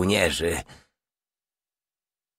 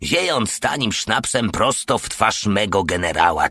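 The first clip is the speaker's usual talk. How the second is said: ordinary delivery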